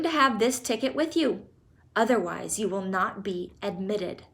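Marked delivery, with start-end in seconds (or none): none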